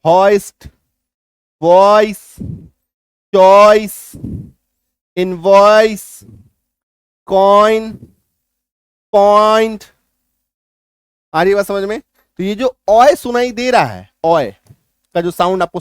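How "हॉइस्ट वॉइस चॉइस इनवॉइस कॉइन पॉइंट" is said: each word called out loud and clear; loud word list demo. Shown as a demonstration. Teacher calls out example words loudly, one by one. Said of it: "बीच में OI".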